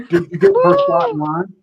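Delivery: high and loud through laughter; laughing question about the snake. Real, not acted. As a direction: ask it while cracking up.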